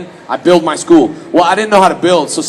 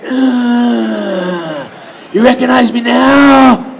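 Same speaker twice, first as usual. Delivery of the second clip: mock porno come-on; 4 semitones higher, much slower than usual. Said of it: "someone doing a porno going".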